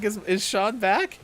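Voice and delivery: high, slow puzzled question; surprised. Retold or imitated. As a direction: ask it in real confusion. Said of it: "I was like".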